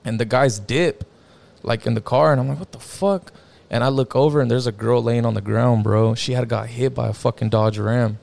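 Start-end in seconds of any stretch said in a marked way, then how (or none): 2.6-3.3 higher, stunned blurt; retold shock. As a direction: react as if just spotting the injured girl. Seeing the girl on the ground.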